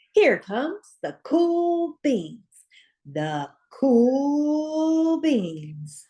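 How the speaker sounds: hammed-up announcer. A narrator's reading. Narrator introducing the cool beans like an announcer, slow and grand. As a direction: roll it out like a big entrance.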